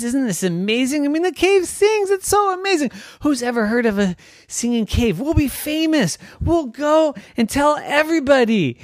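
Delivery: very high, fast, excited gush; bubbling over with excitement; thrilled. Acted. Girl who found a singing cave, excited gush. Trisha gushes to sister.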